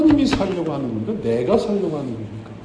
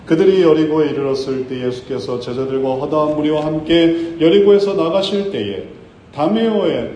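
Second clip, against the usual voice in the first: normal talking voice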